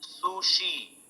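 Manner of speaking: soft "sushi" with sh; quiet "sushi" the Japanese way. Shown as a demonstration.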